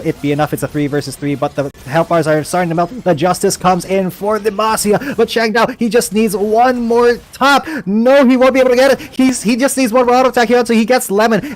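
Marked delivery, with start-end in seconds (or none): 3.1-11.5 rising, fast play-by-play; excited caster. Real, not acted. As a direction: call the fight as it swings. Caster hyping close fight.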